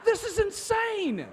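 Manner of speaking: high, stunned disbelief; incredulous disbelief. Retold or imitated. Thinking about the crazy set, rising incredulous disbelief. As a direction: react as if the whole set has gone mad. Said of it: "And I'm thinking".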